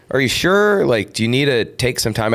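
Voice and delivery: mock the agent's shocked concern; imitating agent.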